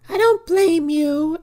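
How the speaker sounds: high, drawn-out mock movie heroine; mock plaintive quote. She hugs her knees at the graveyard, mock plaintive. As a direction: stretch it out in a high, put-on voice.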